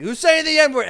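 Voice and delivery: high, loud mock guard bellow; mock shout. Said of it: "and then he's like".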